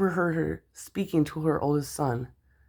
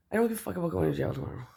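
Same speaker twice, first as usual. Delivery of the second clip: ordinary talk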